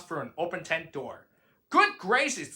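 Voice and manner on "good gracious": gasped. A narrator's reading. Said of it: "gasped Maisie".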